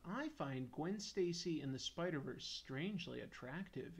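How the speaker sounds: normal talking voice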